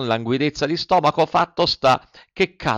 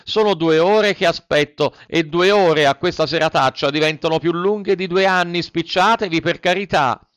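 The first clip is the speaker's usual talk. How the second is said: higher, louder pleading call; shouting puppet; 6 semitones higher, 4 dB louder than usual. A narrator's reading. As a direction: Pinocchio shouts up begging the snail. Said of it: "gridò Pinocchio". (grido supplichevole)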